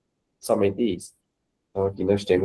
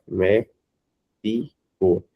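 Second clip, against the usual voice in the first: "mé, di, co" split into three beats; syllable by syllable; 3 semitones lower, much slower than usual. Shown as a demonstration. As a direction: separate each syllable of "mé, di, co". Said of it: "pra não precisar escrever a palavra". ("mé, di, co" dito sílaba por sílaba)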